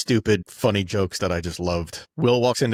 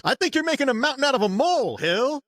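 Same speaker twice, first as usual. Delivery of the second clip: much higher, fast teasing voice; mock Will. Will teasing his cousin in a high fast voice. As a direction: tease it quickly like a cheeky cousin. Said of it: "And he goes".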